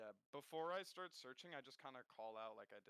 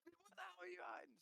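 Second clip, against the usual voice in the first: mocking taunt. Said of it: "Some shit like that".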